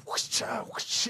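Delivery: sharp whooshing swishes made with the mouth; imitated whip swish. Parent miming a spanking for the listener, imitated swishes.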